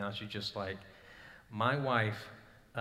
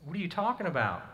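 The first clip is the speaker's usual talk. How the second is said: pleading; 6 semitones higher than usual. Retold or imitated. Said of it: "I mean".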